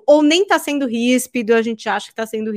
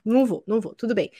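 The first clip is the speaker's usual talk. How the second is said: "Não vou, não vou, tudo bem" said softer, offhand; softly dismissive refusal; casual brush-off; 5 dB quieter, faster than usual. Retold or imitated. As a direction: shrug it off, easy and soft.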